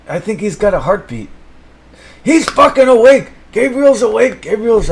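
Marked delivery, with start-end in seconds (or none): 2.2-4.9 excited shout. Acted. Fish revives, celebrating.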